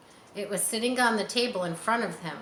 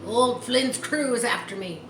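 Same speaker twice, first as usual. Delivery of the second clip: growled; 4 semitones higher, 5 dB louder than usual. A narrator's reading. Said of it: "he growled".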